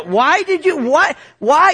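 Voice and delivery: high-pitched mock scream at God; retold screaming. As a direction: plead upward, imitating a rant at God. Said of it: "what I'm screaming to God".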